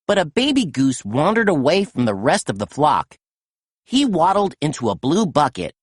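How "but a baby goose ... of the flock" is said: usual speaking voice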